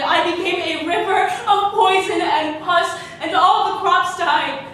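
slow start swelling to high, loud outcry; dramatic crescendo of outrage and pain; building anguish. Acted.

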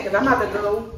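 usual speaking voice

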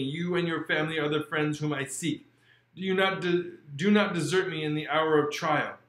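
measured, expressive audiobook narration; steady storyteller voice carrying the chapter; story reading. A narrator's reading. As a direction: read the classic aloud with feeling. Reads Frankenstein aloud.

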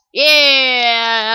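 loud, long "yeah"; drawn-out cheer. Real, not acted. Gamer pulling off a move, long loud cheer. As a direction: stretch out a triumphant yeah. Celebrates in game.

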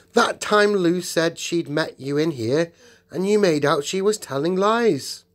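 high, loud outrage; acted angry confrontation; indignant accusation. A narrator's reading. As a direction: accuse them hotly of lying. Peter accuses Edmund of lying.

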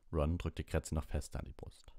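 ordinary delivery, nothing marked

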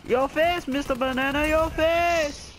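high-pitched playful jeer; taunting comeback. Real, not acted. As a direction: throw it back as a high taunt. Taunting comeback to the other player.

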